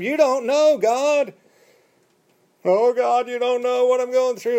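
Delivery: high, sustained plea to God; mock complaint. People telling God he doesn't understand, high mock complaint.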